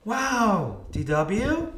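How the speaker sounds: high, loud, impressed wow; exclaiming. A narrator's reading. Buster impressed by D W, exclaiming. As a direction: burst out amazed. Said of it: "Buster exclaimed".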